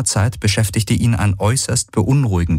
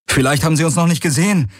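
fast and much higher, hurried; calling out; 10 semitones higher, faster than usual. A narrator's reading. Garland calling while fleeing the swarm, fast high call. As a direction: call it out against hope, rushed. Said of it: "rief Garland wider besseres Wissen".

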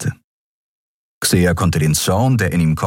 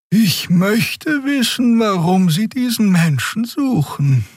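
higher, slow wondering aloud; musing Xia; slower than usual. A narrator's reading. Xia muses aloud to Keru, higher and slow. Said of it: "überlegte Xia laut".

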